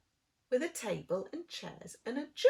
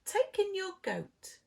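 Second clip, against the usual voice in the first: put-on wise old man; 9 semitones higher than usual. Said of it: "said the wise old man".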